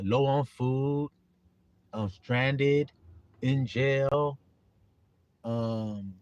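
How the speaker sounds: normal talking voice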